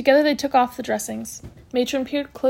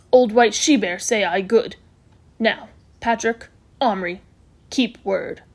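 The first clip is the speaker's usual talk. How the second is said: measured, halting broken English; stern Little Bear; slower than usual. Acted. Patient holding the boys to their promise, stern. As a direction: demand it in short, weighty phrases.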